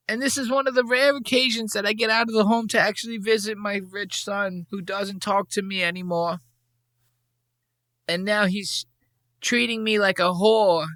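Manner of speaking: put-on old mom voice. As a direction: play a sad old mother grumbling about her son. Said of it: "She's like".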